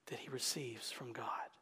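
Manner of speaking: tender whisper. Acted. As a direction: drop to a hush on God. Sentence falls to hush.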